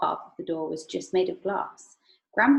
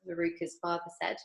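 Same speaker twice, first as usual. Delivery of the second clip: ordinary delivery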